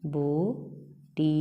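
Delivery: बोटी stretched very slowly and softly; slow soft spelling-out of बोटी; drawn-out word. Shown as a demonstration. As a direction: draw बोटी out gently for children.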